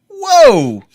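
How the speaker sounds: high, loud startled whoa. Retold or imitated. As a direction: recoil in surprise. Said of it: "I'm like".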